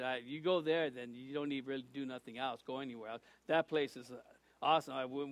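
usual speaking voice

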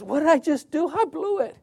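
dismayed realization. Retold or imitated. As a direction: say it as if you just caught yourself failing. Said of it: "You realize".

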